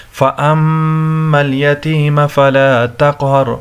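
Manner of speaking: nasal held m in فَأَمَّا, echoing q in تَقْهَرْ; chanted with long nasal m in فَأَمَّا; ghunnah and qalqalah. Shown as a demonstration. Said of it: "ایکو sound کی طرح پڑھیں گے".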